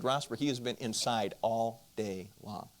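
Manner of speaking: slow, quiet, measured retold rebuke; stern father. Neighbor father defending his son to accuser, slow and stern. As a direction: lay it down slowly and softly, word by word.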